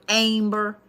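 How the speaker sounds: "Amber" in Oklahoma style as AIM-ber, the I clearly added; Oklahoma accent. Shown as a demonstration. Reader demonstrates the Oklahoma I in "Amber". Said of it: "It has an I in it".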